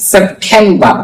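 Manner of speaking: usual speaking voice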